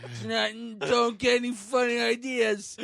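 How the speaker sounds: imitating her prim warning; mock girl warning. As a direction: wag a finger at him. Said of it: "She said".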